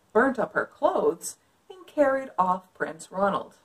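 put-on lively read-aloud for kids; story narration. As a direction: read it like a picture book. Reads picture book aloud.

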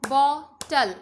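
Bottle in two loud, separated beats; split into syllables. Shown as a demonstration. Teacher leading a clapping drill, bottle split in two. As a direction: leave a clear gap inside bottle. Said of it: "partition में इसे बोलना है".

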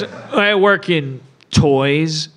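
low, slow, guarded answer; evasive cover story. Acted. Santa lying to his date about his job, evasive. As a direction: drop low and drag out the lie.

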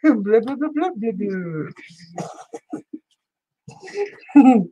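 slowing fish babble; bubble talk. Pretend. Crafter drawing out the fish's bubble talk, slowing down. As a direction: drag the bubbles out lazily. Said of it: "a whole bubble language for the fish".

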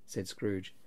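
plain reading voice

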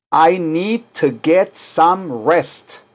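very slow, careful teacher model; slow model for repeating. Shown as a demonstration. Teacher to class, modelling the sentence slowly. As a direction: lay out each word slowly.

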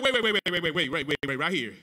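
rapid-fire stutter of waits, falling in pitch; urgent interruption. Retold or imitated. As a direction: halt someone with a quick tumbling repeat. Retells halting a contract signing.

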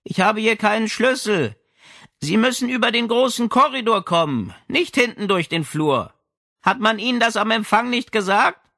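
high, light and loud voice behind a door; girlish old lady. Acted. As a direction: speak as a sprightly old woman, bright and high.

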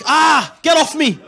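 loud, high, slow mock scream; panicked scream. Comic screams at the kidnapper to get off, hammed-up scream. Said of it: "I'm like".

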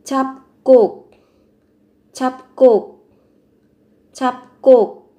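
잡곡 with a tense, doubled k starting the second syllable; doubled consonant. Shown as a demonstration. Teacher models 잡곡 for Vietnamese learners, doubled second consonant. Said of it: "gấp đôi phụ âm ở âm tiết thứ hai".

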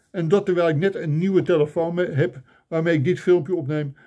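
ordinary talk

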